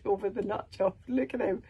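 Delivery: ordinary delivery, nothing marked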